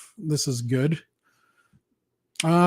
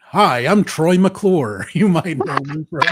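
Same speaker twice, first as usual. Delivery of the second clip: Troy McClure voice; 4 dB louder than usual. Retold or imitated. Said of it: "you sound like a".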